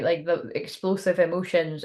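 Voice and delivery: usual speaking voice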